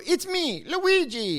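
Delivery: high cartoon Italian accent; Luigi. Comic imitating the Mario Brothers, cartoon Italian accent. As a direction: sing out a cheesy Italian accent. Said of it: "Or you do the Italian".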